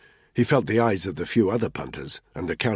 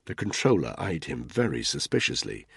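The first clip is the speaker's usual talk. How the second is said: usual speaking voice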